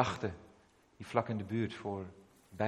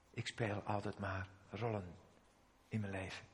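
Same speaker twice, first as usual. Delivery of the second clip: crying harder; 4 semitones lower, 11 dB quieter than usual. Retold or imitated. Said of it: "begon hij nog harder te huilen".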